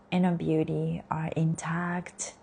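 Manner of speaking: soft whispered affirmation; whispering. Acted. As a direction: breathe the words out in a whisper.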